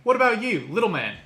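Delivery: high loud laughing bark at the little man; big laughing half-orc holler; loud gruff greeting. Acted. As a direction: call out loud, cracking up. Half-orc barking at gnome, laughing.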